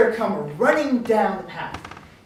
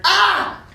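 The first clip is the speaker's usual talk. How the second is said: short high loud scream; 11 semitones higher, 8 dB louder than usual. Acted. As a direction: let out a sudden startled "Ah!". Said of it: "did you just scream".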